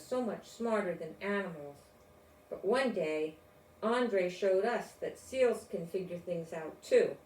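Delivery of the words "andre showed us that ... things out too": ordinary delivery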